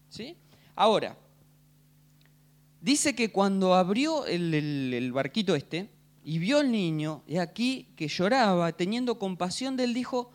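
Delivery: usual speaking voice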